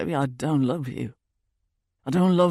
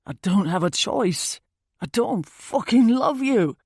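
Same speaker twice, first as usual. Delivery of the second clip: forceful male outburst, getting louder; angry man; 5 dB louder than usual. Acted. Boyfriend blurts he doesn't love her, loud and angry.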